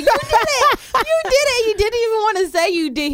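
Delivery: high, loud accusing cry. Retold or imitated. Confronting the coworker who ate her pizza, high loud accusation. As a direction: point the finger, high and loud.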